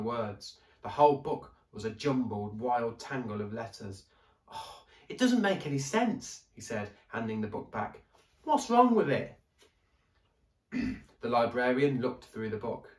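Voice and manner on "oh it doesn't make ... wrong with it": put-on higher, louder puzzled boy; frustrated boy. A narrator's reading. Daniel handing back the jumbled book, higher puzzled boy. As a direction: sound baffled and fed up.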